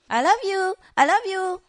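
high, loud parrot squawk; enticing bird. Retold or imitated. Bird luring its faraway owner over, high coaxing parrot imitation. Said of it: "he wants to entice me".

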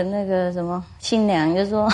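ordinary talk

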